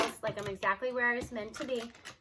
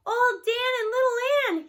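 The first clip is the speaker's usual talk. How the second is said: high, loud, choked-up gasp; a boy gasping over a sad book; gasping in grief; 12 semitones higher, 8 dB louder than usual. Acted. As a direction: force the names out through shock. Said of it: "he gasps".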